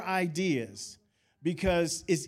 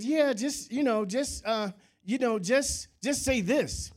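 higher-pitched retold wheedling; mock-coaxing, pitched up; 6 semitones higher than usual. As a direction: retell yourself talking someone into it. Retelling himself coaxing a friend.

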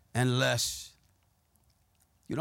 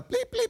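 high-pitched mock bleeps; squeaked bleeps in place of curses; imitated censor bleep; 4 dB quieter than usual. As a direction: voice a censor tone over swearing. Censors his own retold swearing.

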